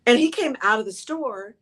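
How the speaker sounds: ordinary delivery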